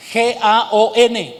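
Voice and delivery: G A O N letter by letter, slowly; spelled out. Shown as a demonstration. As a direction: spell G A O N one letter at a time. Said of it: "con acento en la O". (deletreando despacio G A O N, letra por letra)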